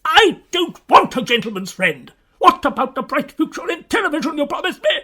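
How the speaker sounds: loud, high, rapid tirade; furious shout. Acted. Host confronting agent over the promised television future, furious shouting. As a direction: rage at full volume, racing on.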